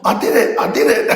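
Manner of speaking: put-on high, excited little-girl cheer; a girl cheering after her first climb; proud daughter.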